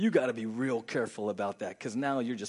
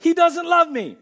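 much higher, louder wounded cry; hurt wife; 14 dB louder than usual. Pretend. Wife hearing her husband's excuse, mock wounded. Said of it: "In your mind, you're like".

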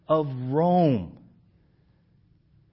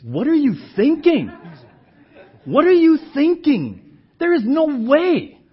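loud, high, rising protest; indignant rebuke; 11 semitones higher, 9 dB louder than usual. Retold or imitated. Peter pulling Jesus aside, loud indignant rebuke. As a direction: push back hard, shocked and indignant. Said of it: "he takes him to the side and says".

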